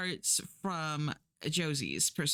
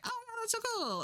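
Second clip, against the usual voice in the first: breezy high reply; much faster than usual. Retold or imitated. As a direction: toss it off sky-high and airy. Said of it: "And Alex is like".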